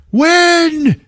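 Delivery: very high shouted cue; imitated urgent yell; yelled signal. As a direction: call it out across the room. Stone calls out the signal.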